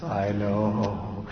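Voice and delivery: low, soft, drawn-out "I know"; hushed, unruffled parent reply. Shown as a demonstration. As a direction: answer very quietly and evenly, voice dropped.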